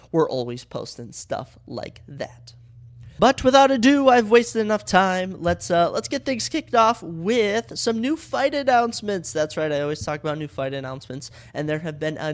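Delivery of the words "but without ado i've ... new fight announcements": louder and higher, hyped announcer launch; hype kickoff. Acted. Podcast host to his listeners, launching the fight news, louder and higher. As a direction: pump it up like opening the main card.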